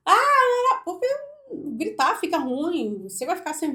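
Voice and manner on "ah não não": high, loud demo of shouting; brief exaggerated high cry; mock yelling. Shown as a demonstration. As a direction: show how not to do it, yelling. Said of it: "nunca gritar".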